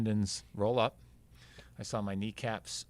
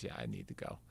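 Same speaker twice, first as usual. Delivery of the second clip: breathy, pained whisper, much lower and softer; weak whisper; 14 semitones lower, 6 dB quieter, faster than usual. Retold or imitated. Injured runner telling paramedics he needs the hospital, whispered. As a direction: barely voice it, like you're hurting.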